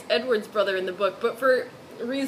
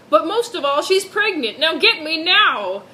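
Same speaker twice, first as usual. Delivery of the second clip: loud yell; 4 semitones higher, 6 dB louder than usual. Acted. Edward hears the brother yelling outside, loud. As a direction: bellow it across the parking lot. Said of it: "heard something loud".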